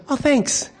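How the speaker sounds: offhand, barely grateful mock thanks; underwhelmed thanks. Wife thanks husband for finding her keys, offhand mock thanks. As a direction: toss off a half-hearted thank-you. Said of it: "you gotta have a little more than that".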